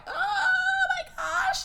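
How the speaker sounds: high, cracking startled cry; man sees bloody footprints from the shower, shocked cry; horrified cry. Retold or imitated. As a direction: gasp it out in horror.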